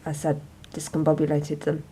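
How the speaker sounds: low, quiet, deadpan; deadpan repeat. Pretend. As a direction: say it flat and quiet for effect. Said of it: "shocking stuff".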